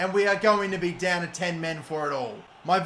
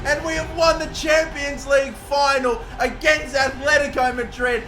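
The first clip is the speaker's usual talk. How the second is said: high, louder victory yell; triumphant shout; 7 semitones higher, 5 dB louder than usual. Real, not acted. Gamer celebrating winning the Champions League final, triumphant. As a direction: proclaim the win with full joy.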